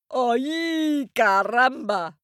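high, loud pained yelp. Acted. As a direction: yelp like a bitten ram. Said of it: "yelped the Ram".

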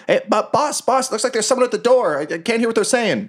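high-pitched, fretful goblin voice; goblin underling. Acted. Goblin reporting someone at the door to his boss, high goblin voice. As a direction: call out to the boss as a squeaky minion. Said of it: "you hear someone go".

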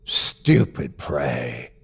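slow, low croaking creature voice; croaking burble. Acted. The thing croaking at the narrator, slow and hideous. Monster voice.

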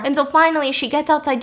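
ordinary talk

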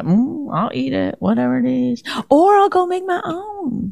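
high, soft demonstration voice; put-on agreeable voice. Wife showing how she'd accept a meal, high soft voice. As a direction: pitch it up, softly, going along. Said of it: "Is that how you would talk?".

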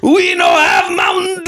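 high mock Italian shout; hammed-up indignant Italian shopkeeper; Giuseppe. Old Italian refuses customer, high mock-angry shout. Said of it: "Some old Giuseppe comes outside".

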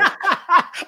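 sudden high delighted laugh; burst of high laughter. Real, not acted. Guest cracks up at the host's bet, bursting out laughing. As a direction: laugh out loud, caught off guard.

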